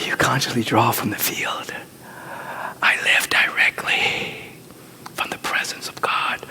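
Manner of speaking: hushed, breathy prayer whisper; whispered affirmation. Acted. Preacher to congregation, whispering the affirmation. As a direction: draw them in with a soft whisper.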